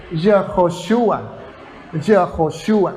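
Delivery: Yehoshua said slowly, each syllable set apart; Hebrew pronunciation. Shown as a demonstration. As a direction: Yehoshua pronounced slowly and carefully, Hebrew style. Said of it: "indica que se pronuncia Y".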